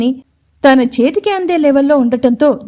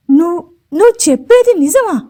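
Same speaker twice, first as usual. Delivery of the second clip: higher, stammering with fear; fearful question; 5 semitones higher than usual. A narrator's reading. Jeevan fearfully asks Mounika if it's true, mildly scared. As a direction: ask in mild fright. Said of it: "భయంగా అడిగాడు జీవన్".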